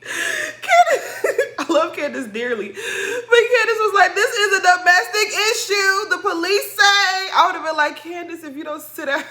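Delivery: high, helpless laughter through the words; laughing. Real, not acted. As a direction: crack up while retelling it. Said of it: "that shit made me laugh".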